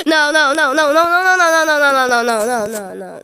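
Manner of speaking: loud rapid string of refusals; panicked protest. Real, not acted. Gamer watching the round slip away, loud running wail. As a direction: wail a chain of no's, loud then sinking. Losing in the game.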